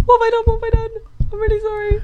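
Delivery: very high, squeaky mock panic; panicked child. Child dreading getting home after mischief, high mock panic. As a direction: plead in a tiny high voice, hammed-up. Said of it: "your heart would be going".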